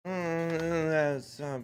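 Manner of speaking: mumbled half-asleep imitation; slurred sleepy mumble, played back; sleep talking. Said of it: "known to sleep talk at me".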